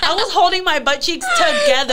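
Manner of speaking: giggling at her own story; laughing. Real, not acted. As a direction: break into a laugh mid-sentence. Laughing retelling painful moment.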